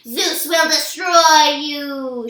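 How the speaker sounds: slow, loud monster threat; menacing promise. A narrator's reading. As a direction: growl a slow promise of doom. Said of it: "she promised".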